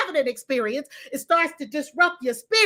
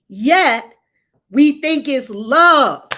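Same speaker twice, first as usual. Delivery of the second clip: ordinary delivery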